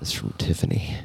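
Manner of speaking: hushed aside; whispering. Real, not acted. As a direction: say it under your breath.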